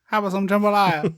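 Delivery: hammed-up Southern food offer; mock New Orleans accent. Heroine offering Johnny dinner, mock New Orleans accent.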